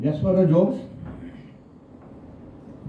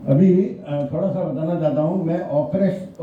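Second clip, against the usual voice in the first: usual speaking voice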